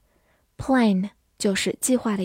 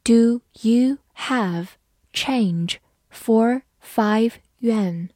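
slow, careful classroom read; slow model reading for learners; much slower than usual. Shown as a demonstration. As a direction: read each word slowly and clearly. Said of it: "好，慢读一遍".

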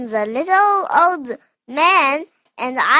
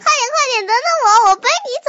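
pig's coaxing call; 8 semitones higher, much faster than usual. Acted. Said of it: "你说那应该怎么喊".

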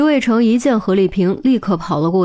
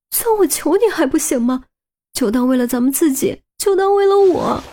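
pleading; 6 semitones higher than usual. Acted. Woman begging him to stop.

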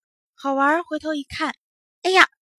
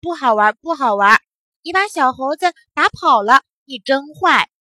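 put-on loud children's telling-off; indignant scolding; 3 semitones higher, 7 dB louder than usual. A narrator's reading. Other children blaming the boy for hitting the monkey, indignantly. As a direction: scold him firmly, stressing the accusation.

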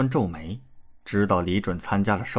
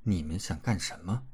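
coldly; 2 semitones lower, 7 dB quieter than usual. A narrator's reading. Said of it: "冷冷道".

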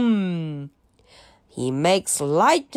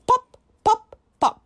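high squeaky pop pop pop; cork popping; 12 semitones higher, much faster than usual. Pretend. Teacher showing the children a cork popping, high and bright. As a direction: pop it out like a cork leaving a bottle. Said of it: "他会变瓶盖的声音".

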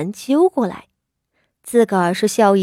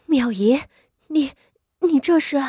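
halting, higher-pitched stammer of disbelief; stunned; 6 semitones higher than usual. A narrator's reading. Stunned mother sees her daughter's swollen face, faltering in shock. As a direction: falter in shock, breaking off between words. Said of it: "谢氏傻了眼".

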